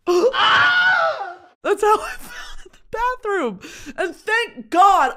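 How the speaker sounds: high, slow, crying-out wail; hammed-up wailing. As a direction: wail it like a melodrama. Reliving the burn.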